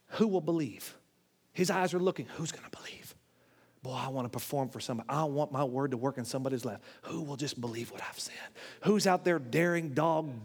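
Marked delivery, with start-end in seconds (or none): 2.4-3.2 soft, searching whisper; hushed, voiceless question. Acted. 3.8-8.3 low, soft, confiding voice; quiet, low and intimate; hushed longing. Acted. God's wish voiced softly.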